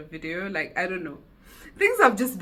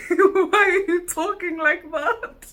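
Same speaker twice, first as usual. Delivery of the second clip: laughing; 10 semitones higher, 4 dB louder, slower than usual. Real, not acted. Laughing at mom.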